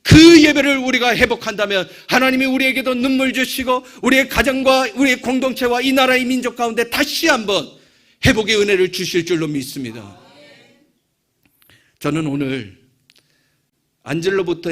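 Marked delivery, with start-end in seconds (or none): 0.0-10.2 loud, high, impassioned preaching; fervent sermon climax, loud and high; full-force preaching. Acted. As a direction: preach it out to the whole church.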